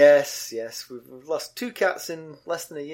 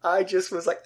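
usual speaking voice

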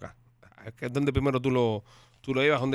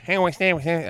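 a light, slightly raised take on a footballer's odd voice; mock Beckham voice; 4 semitones higher, 4 dB louder than usual. Friend mocking David Beckham's voice on a podcast, mild mock impression. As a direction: tease how he sounds when he opens his mouth. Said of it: "Él habla con una voz así".

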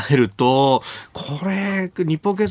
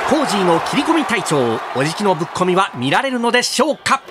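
sports commentator; 9 semitones higher than usual. Acted. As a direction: hype play-by-play voice.

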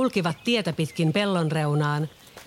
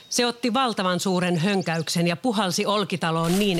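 ordinary delivery

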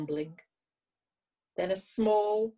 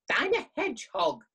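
higher, louder curt snap; snappish; 8 semitones higher, 5 dB louder than usual. Acted. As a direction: bite the words off. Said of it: "it snapped".

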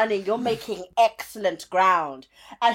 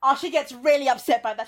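ordinary talk, nothing marked

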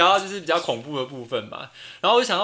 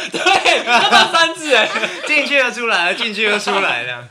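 laughing; 5 semitones higher, 10 dB louder than usual. Real, not acted. Laughing about Daniel's toilet trips.

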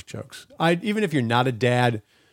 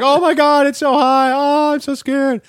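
much higher, loud mock panic, slowing at the end; hammed-up fright; 14 semitones higher, 7 dB louder than usual. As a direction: ham up being scared of the ride for the kids. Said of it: "I started doing that routine".

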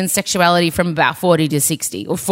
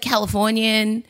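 ordinary delivery

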